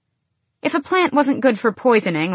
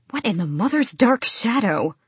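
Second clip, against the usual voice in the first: higher, shocked and repulsed; disgusted outburst; 4 semitones higher than usual. A narrator's reading. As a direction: spit it out in disgust. Spits out the meat, disgusted.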